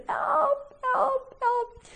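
high, thin, not-quite-human wail; eerie cry for help. Acted. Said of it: "doesn't really sound like a human".

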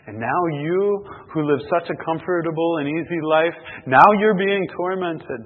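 high, booming preacher's indictment; loud rebuke. Acted. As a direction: thunder it at the condemned man, drawing out the end. Preacher as Abraham rebukes the rich man.

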